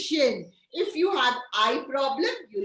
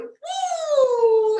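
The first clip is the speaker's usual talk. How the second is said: high, loud "woo"; long whooshing mock jab noise; injection sound effect; 13 semitones higher, 8 dB louder than usual. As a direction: whoop as the pretend needle goes in. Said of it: "Lubaina teacher gave".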